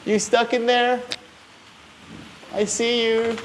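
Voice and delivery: high sing-song voice for the cat; pet talk. Real, not acted. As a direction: coo up high to a kitty. Talking to the cat.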